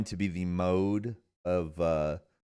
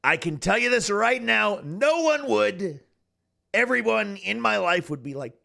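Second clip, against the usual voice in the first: high-pitched presidential impression; Donald Trump; 9 semitones higher than usual. Streamer vowing to talk so forever, Trump impression. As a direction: parody voice held in full swing. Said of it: "just talk like this".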